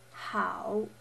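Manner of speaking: "how" dipping then rising; third tone. Shown as a demonstration. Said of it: "pronunciation tones".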